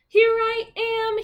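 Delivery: high, loud, slow triumphant crow; a newborn raven crowing out his arrival. Acted. Just-hatched raven crowing to an empty nest, high and slow. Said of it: "uh, crowed".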